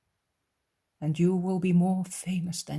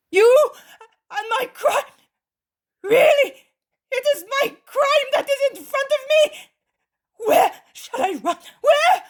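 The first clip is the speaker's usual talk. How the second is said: sobbing shriek, very high and loud; panicked crying scream; terrorized crying; 12 dB louder than usual. Acted. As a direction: howl it as if your crime stands before you. Terrorized man cries out.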